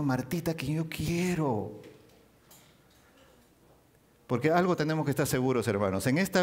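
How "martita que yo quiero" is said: tender. Acted. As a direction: say it lovingly, drawn out. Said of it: "le está diciendo". (Jesús hablando con cariño a Marta)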